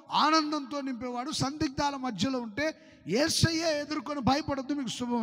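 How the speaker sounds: full-force preaching. Acted. Pastor assuring fearful believers, loud fervent preaching. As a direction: preach it with fire.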